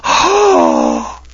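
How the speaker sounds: a hammed-up shocked cry; mock shocked oh. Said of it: "they gasp and they go".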